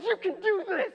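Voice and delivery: high, loud coaching shout; spotter yelling. Retold or imitated. Gym spotter yelling encouragement at the lifter. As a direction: cheer him on at full voice. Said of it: "he's, like, yelling at me".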